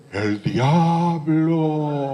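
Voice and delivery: drawn-out sung "¡El diablo!"; a mock devil answering from the dark; spooky devil voice. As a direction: stretch it like a ghostly moan. Superior pretends to be devil.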